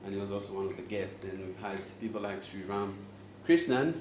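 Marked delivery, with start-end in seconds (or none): none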